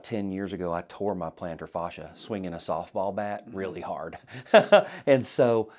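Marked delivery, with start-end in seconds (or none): 4.2-5.0 sudden burst of laughter; high, loud laugh. Real, not acted. Laughing at own injury story.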